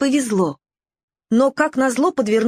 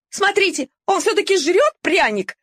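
much higher, outraged yapping; indignant yelp; 9 semitones higher than usual. Acted. Mongrel pointing out the puppy to the pack, indignant. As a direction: yap it out in outrage. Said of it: "возмутилась Вилька".

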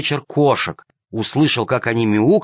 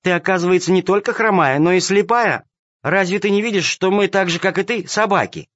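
mocking kitten; 8 semitones higher than usual. A narrator's reading. Kitten mocks the lame cat.

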